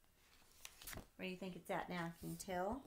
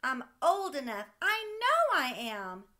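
put-on insistent little boy; 6 semitones higher, 13 dB louder than usual. Sam shouting to dad he is old enough, insistent child. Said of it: "asked Sam".

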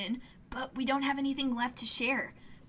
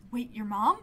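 surprised; 3 semitones lower than usual. Real, not acted. Player surprised by reveal.

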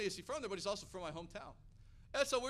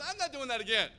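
imitating a resolute wife, loud and higher; determined refusal; 5 semitones higher, 10 dB louder than usual. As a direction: put your foot down, hammed up. Wife refuses to miss out again.

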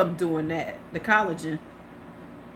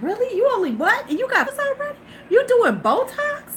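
high, shocked disbelief; incredulous; 13 semitones higher than usual. Retold or imitated. To young women with fillers, high shocked disbelief. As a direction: squeal it in disbelief. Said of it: "I was like".

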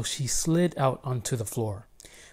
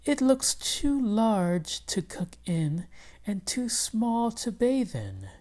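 higher, slow wife voice; the wife muses, high and slow; wife's voice; 8 semitones higher, slower than usual. Acted. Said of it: "said Missus Haktak".

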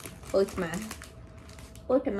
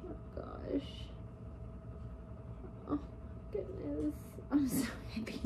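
whisper; 8 semitones higher, 11 dB quieter than usual. Real, not acted. Admiring a leaf.

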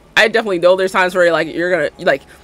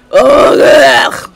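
ugh; 5 semitones higher, 9 dB louder than usual. Real, not acted. Frustrated with outfit.

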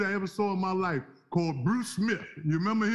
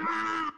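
mock horrified cry; 9 semitones higher than usual. Horrified reaction to "Me.

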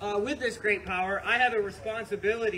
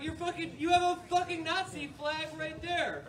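exasperated retort; 8 semitones higher than usual. Retold or imitated. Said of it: "and I'm like".

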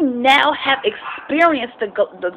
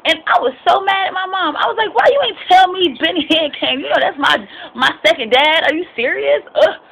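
higher, indignant complaint to mom; mock outrage at being left out; retold mad attitude; 6 semitones higher than usual. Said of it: "I got mad attitude with her".

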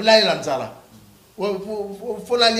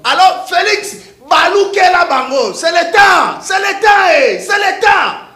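high, loud, rallying call; rally him hard; loud exhortation; 8 semitones higher, 7 dB louder, slower than usual. Real, not acted. Urging Félix to act, loud rallying shout.

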